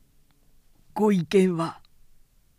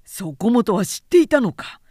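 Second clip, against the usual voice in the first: higher, louder, wide-eyed question; astonished; 5 semitones higher, 5 dB louder than usual. A narrator's reading. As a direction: ask it in open astonishment. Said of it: "勘右衛門は目を見張った". (目を見張って、驚いた声で)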